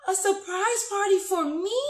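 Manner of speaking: gasp, then high delighted exclamation; excited surprise. Shown as a demonstration. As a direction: squeal it like a happy shock. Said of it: "it's excitement nonetheless".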